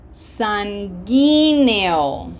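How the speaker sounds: "Sanguíneo" very slow with GUÍ punched; slow teacher's model of "Sanguíneo"; accented syllable. Shown as a demonstration. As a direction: stretch "Sanguíneo", leaning on the accented í. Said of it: "Graphic accent".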